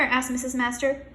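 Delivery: ordinary delivery, nothing marked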